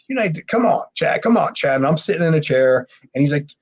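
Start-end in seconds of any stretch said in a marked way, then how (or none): 0.1-1.8 high-pitched egging on, retold; pushy mock encouragement; goading. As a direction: imitate the guys urging him on. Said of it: "they're goating him and goating him".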